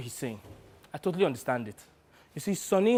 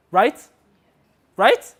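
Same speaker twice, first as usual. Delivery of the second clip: loud rising question, pushed higher on repeat; seeking agreement; 5 semitones higher, 11 dB louder than usual. Acted. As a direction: press for a yes, voice shooting up. Seeks agreement from the listener.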